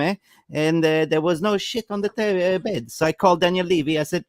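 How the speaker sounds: mock Italian manager ranting in broken English; Antonio Conte impression. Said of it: "I'm Antonio Conte".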